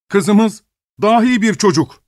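slow, high amazed cry; astonished exclaim. A narrator's reading. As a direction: cry out in wonder, slowly. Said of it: "diye haykırdı şaşkın baba".

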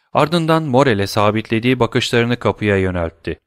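plain reading voice

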